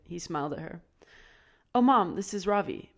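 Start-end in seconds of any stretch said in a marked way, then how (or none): 1.7-2.9 eager introduction. A narrator's reading. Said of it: "Pip said".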